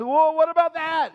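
high, pointed parental question; suspicious parent. Retold or imitated. Parents grilling a child caught in a story, pointed and high. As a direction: catch the kid out with "what".

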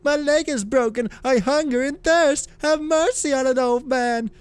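high, pitiful beggar's wail; crying beggar. Acted. Old beggar pleading with a rich novice, high wail. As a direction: beg for mercy in a high, held-up cry. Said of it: "he cried".

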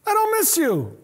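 high, dismissive mock line; mock careless voice. Believer brushing off God, mock careless. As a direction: toss it off lightly. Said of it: "We insult him when we say".

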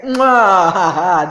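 loud "mwah-ha-ha" cackle; angry laugh. Real, not acted. Announcing it goes on her website and YouTube, loud mocking cackle. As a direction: burst into a big taunting laugh. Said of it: "I'm laughing because I'm angry".

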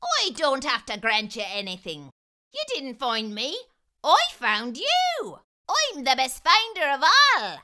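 rising to a high, loud brag; boastful leprechaun. Acted. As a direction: crow that you're the best. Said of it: "the leprechaun scoffed".

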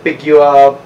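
ordinary talk, nothing marked

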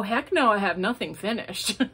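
ordinary talk, nothing marked

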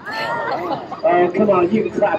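ordinary talk, nothing marked

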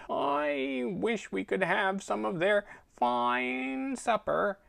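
high, slow, drawling cat voice; a sulky, bored old cat; snooty bored cat. Acted. Cat longs for supper.

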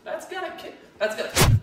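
a hint of an impressed eater, high then hushed; imitated eater. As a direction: play a guest tasting the kick. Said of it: "they go".

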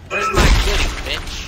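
high, loud yelling call; loud shout. Acted. Shouting during horror game.

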